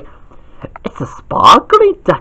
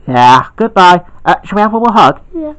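ordinary talk

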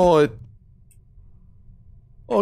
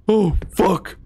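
ordinary talk

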